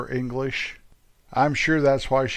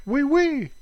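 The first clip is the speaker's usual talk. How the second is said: high playful fake French; mock French oui oui; 11 semitones higher than usual. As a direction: squeak it out like a comic Frenchman.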